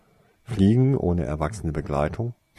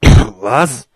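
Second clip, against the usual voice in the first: very loud, raised, rude demand; unfriendly; 4 semitones higher, 15 dB louder than usual. A narrator's reading. Man answering the door at night, unfriendly shout. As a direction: fire it out rudely at unwelcome callers. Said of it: "sagte er unfreundlich".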